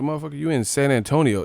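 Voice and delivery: ordinary talk, nothing marked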